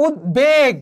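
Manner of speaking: উদ্বেগ said slowly as ud-beg, b fully sounded; b fully pronounced. Shown as a demonstration. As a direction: split উদ্বেগ after the d, then a clear b. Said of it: "এখানে ব ফলার উচ্চারণ হয়েছে।".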